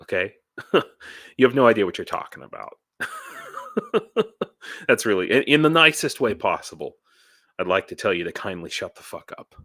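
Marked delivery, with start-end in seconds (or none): 3.0-4.8 real laugh. Real, not acted. Laughs at own rant.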